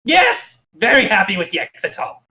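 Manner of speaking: high yelped yes from a cowed minion; high, anxious goblin answer falling at the end; nervous goblin. Acted. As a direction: placate the boss like a nervous goblin underling. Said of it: "looks nervously and goes".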